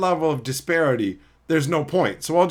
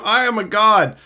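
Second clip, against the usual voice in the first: loud, raised mock scream; imitated scream; 6 semitones higher, 6 dB louder than usual. Reading out a chat message about boastful players, mock scream. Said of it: "when they scream".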